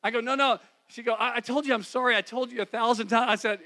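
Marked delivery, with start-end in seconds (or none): 0.2-0.6 higher and louder insistent no; urgent protest. Retold or imitated. Husband pressing his wife to talk it over, urgent protest. Insisting during their argument. 1.1-3.3 higher and louder, fed-up pleading; she's sick of apologising; exasperated wife. Retold or imitated. Wife refusing to revisit the ride again, exasperated.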